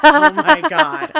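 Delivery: slow amused laugh through the words. Real, not acted. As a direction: laugh it out slowly. Laughing over the absurd quote.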